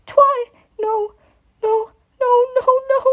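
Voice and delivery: high sobbing string of no; crying no. Acted. Baby dragon crying out in his nightmare, high and sobbing. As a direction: cry out no again and again.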